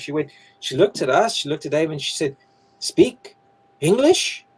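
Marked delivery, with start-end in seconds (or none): 2.8-4.5 loud, high two-word question; raised pitch, each word set apart; non-native English speaker. Retold or imitated. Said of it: "this Chinese lady, whose English wasn't first language".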